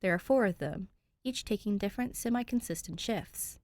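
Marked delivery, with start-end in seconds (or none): none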